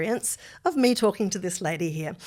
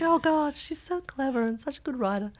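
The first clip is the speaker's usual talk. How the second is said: imitating husband; 7 semitones higher than usual. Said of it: "It's like".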